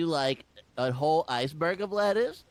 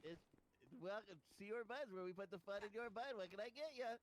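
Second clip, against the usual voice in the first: cashier greeting; 5 semitones higher, 21 dB quieter than usual. Acted. Fast food greeting routine.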